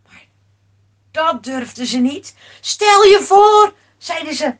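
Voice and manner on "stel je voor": slow, loud, raised exclamation; alarmed protest. Acted. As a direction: protest loudly, stretching the words. Sailors object in alarm. (luid en langzaam protesteren)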